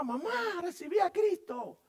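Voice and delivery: high, loud joyful announcement; excited girl. Retold or imitated. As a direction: tell mom the big news breathlessly. Girl calls her mother.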